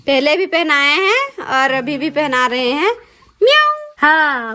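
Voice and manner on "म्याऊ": playful meow. Acted.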